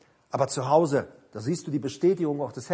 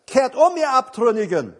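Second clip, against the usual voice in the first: raised, loud, emphatic preacher's call; proclaimed divine call; 7 semitones higher, 7 dB louder than usual. Acted. God calls the unfaithful back, proclaimed loudly. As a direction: proclaim it high, drawing out the ending.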